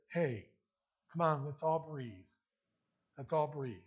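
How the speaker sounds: low, gentle counselor's voice; calming. Acted. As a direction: bring it down and settle them. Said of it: "bring that down to a level".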